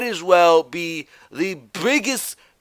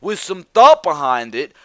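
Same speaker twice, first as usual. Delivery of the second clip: normal talking voice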